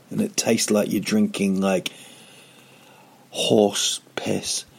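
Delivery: usual speaking voice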